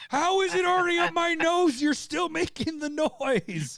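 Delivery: high, disbelieving yelp, slowing down; shocked outcry. Retold or imitated. Sober friend shocked by the fart already up his nose, high outcry. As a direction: shriek in stunned disbelief.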